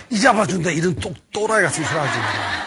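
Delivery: loud mocking scold; scolding outburst. Moses scolding the shepherd.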